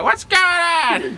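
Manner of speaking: very high, slow screech; playground monitor's yell. Retold or imitated. Imitating the monitor who caught him, drawn-out high yell. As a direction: shriek it loud, dragging each word out. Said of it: "she talked like that".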